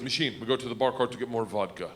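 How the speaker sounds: low Big Igor-style gangster voice; Russian accent. Retold or imitated. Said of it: "Big Igor stands up and he goes".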